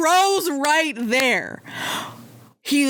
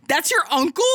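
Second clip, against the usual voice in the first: higher-pitched, baffled disbelief; incredulous question; 5 semitones higher, faster than usual. Acted. Weiss reacts to Ruby's excitement over her uncle, incredulous higher question. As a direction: question it in mock astonishment.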